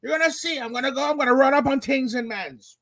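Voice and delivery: high-pitched mock street yelling; hammed-up screaming tough guy; imitated screaming threat. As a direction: shout it high, then ease off while staying high. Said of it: "he's screaming".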